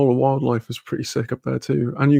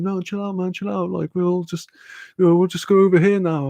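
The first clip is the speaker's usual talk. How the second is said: imitated placating; 5 semitones higher than usual. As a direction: play the mates calming someone down, lightly and higher. Mates calming him, retold.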